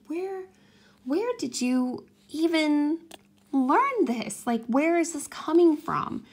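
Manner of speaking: where on earth did she get that, said in disbelief; baffled disbelief. Real, not acted. Incredulous about daughter's threat.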